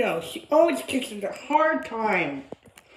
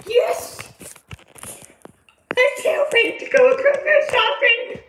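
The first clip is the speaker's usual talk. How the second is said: excited squeal; 11 semitones higher, 5 dB louder than usual. Acted. Girl squeals about shopping.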